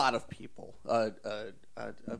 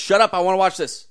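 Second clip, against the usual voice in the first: high, loud demand to hush the room; 7 semitones higher, 9 dB louder than usual. Acted. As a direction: shout over the party chatter. Acts out hushing party guests.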